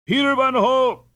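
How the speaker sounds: high, loud calling out of the name; crier's shout. Acted. Crier announcing the winner to the crowd, loud high shout. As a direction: proclaim the winner to the whole river. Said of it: "shouted the crier".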